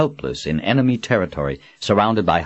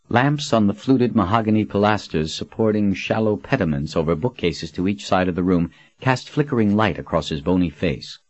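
plain reading voice

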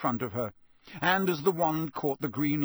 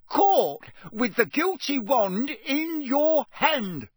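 raised, louder, very slow roar; roared accusation; 13 semitones higher, 6 dB louder, much slower than usual. A narrator's reading. Official accusing the elf, roaring. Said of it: "Mister Diggory roared".

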